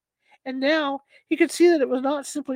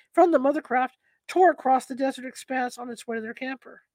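plain reading voice

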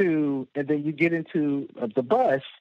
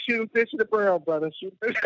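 mock bravado; 6 semitones higher, faster than usual. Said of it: "It's like".

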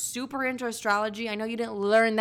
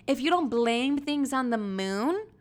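usual speaking voice

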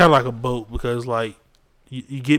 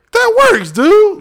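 much higher, loud excited burst; 11 dB louder, much slower than usual. Real, not acted. Excitedly defending a friend's bad impression, high and loud. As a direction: blurt it out, thrilled.

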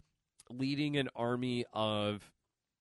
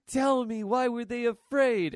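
higher, louder, insistent yell at Luke; demanding shout; 9 semitones higher, 6 dB louder than usual. Retold or imitated. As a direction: call out to Luke, demanding an answer. Rey demands answer from Luke.

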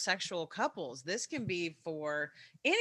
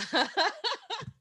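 high giggle; 13 semitones higher than usual. Real, not acted. Laughs before closing line.

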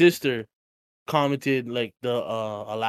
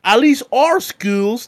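put-on country accent; 10 semitones higher, 8 dB louder, much slower than usual. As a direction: stretch "at least our schools" like a smug country Brit. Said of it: "in a country accent".

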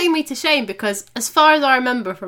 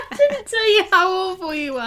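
laughing; 7 semitones higher, 3 dB louder than usual. Real, not acted. Protesting through laughter.

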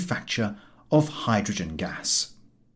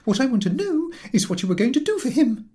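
high, louder lady's retort; rising key; 3 dB louder than usual. Acted. Angry mother retorts to the chief, voice rising high. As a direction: snap it back with the pitch climbing. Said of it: "her key continuing to rise".

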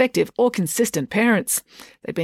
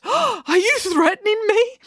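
high, slow, frightened whimper; slower than usual. A narrator's reading. Dad whimpers at the constable, high and slow. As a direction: quaver it out in terror. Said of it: "he whimpered".

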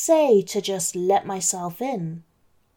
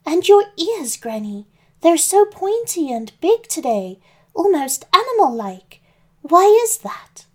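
put-on higher, louder sweet girl voice; little girl voice; 8 semitones higher, 4 dB louder than usual. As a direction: ask it like an innocent little girl.

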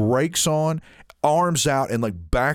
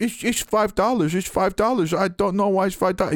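a hint of a confused cashier, higher; mock the puzzled register lady, pitched up; baffled cashier; 8 semitones higher than usual. Teller imitates the confused cashier, pitched up.

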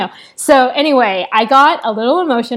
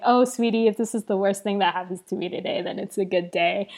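softer, quick, kindly reassurance; gentle reassurance; 6 dB quieter than usual. Retold or imitated. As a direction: soothe her like a kind stranger, softly. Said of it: "she was like".

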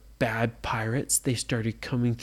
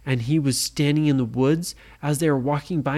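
ordinary delivery

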